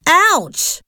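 high, sharp cry of pain; acted yelp, moderate volume; pained shout. A narrator's reading. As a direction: yelp as if just hurt. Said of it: "he shouted".

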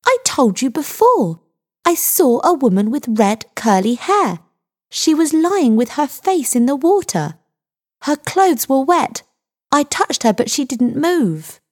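high-pitched, emphatic retelling; indignant insistence. A narrator's reading. Girl repeating her account to doubting sergeant, insistent.

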